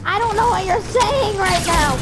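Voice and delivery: ordinary talk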